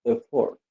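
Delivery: "effort" high on the first syllable, then falling; stress on first syllable. Shown as a demonstration. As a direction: punch the start of "effort". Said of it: "on the first syllable".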